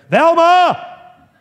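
booming, high-pitched holler to his wife. Acted. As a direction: call her name at full volume, held long. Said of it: "he hollered out to my mother".

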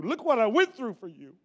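insistent rising complaint; mock complaint. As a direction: press the complaint harder the second time. Complaint repeated, pushed harder.

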